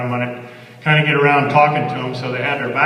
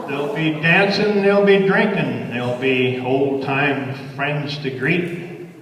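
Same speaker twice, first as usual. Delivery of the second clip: lively cowboy verse, lilting; cowboy poem recitation; 3 semitones higher than usual. Acted. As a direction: recite it like a campfire cowboy poet.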